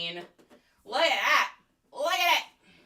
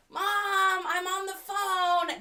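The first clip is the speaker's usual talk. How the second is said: put-on teen calling to mom; 10 semitones higher, slower than usual.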